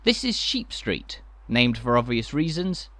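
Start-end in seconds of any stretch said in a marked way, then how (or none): none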